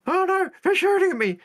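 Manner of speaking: high, loud mock panic; cowardly NPC. Mimicking a panicking NPC crewman, high mock panic. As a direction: squeal like a frightened crewman. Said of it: "You know, the coward".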